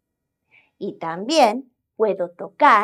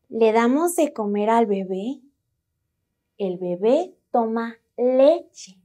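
ordinary talk

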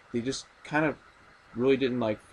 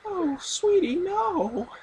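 very high, sugary mom voice; sweetly insistent; much slower than usual. Retold or imitated. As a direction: squeal it like a doting mother. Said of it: "imitating Norma, the mother".

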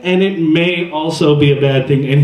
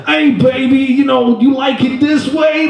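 Tracy Morgan impression; 11 semitones higher than usual. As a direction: ham up a wild, squealing pickup line. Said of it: "And Tracy Morgan is just a no".

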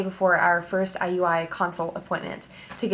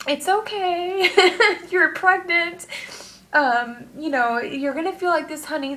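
grandmother's comfort; 7 semitones higher than usual. Retold or imitated. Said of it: "she would have just been like".

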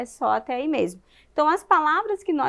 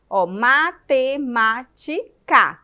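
"matemática" slow and loud, ma-te-má-ti-ca; syllable by syllable; 5 dB louder, much slower than usual. Shown as a demonstration. Teacher counting mouth openings for children, loud separated syllables. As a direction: punch each syllable of "matemática" separately, full final ca. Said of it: "Quantas vezes você abre a boca".

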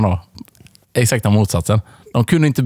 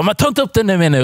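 mock furious; 6 semitones higher than usual. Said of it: "Och hon blev skitförbannad".

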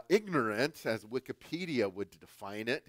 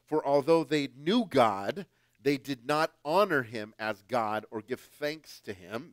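scripture reading; 7 dB louder than usual. Acted. Preacher reads scripture aloud.

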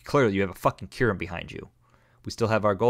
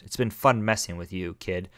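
ordinary talk, nothing marked

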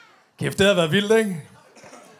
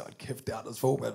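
much softer, low hushed aside; 7 semitones lower, 11 dB quieter than usual. Retold or imitated. Friends at a red light, hushed while peeking in. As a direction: whisper it while staring in. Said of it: "vi siger".

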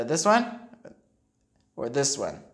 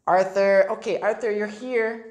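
ordinary delivery, nothing marked

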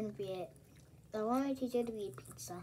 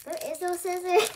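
teasing; 6 semitones higher, 7 dB louder than usual. Pretend. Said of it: "I'm just joking".

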